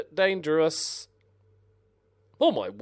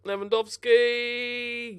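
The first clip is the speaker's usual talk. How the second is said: slow, high stretched-out name; drawn-out dread; 10 semitones higher, 4 dB louder, much slower than usual. Real, not acted. Gamer watches the rival striker bear down, dreading. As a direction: drag the name out in alarm.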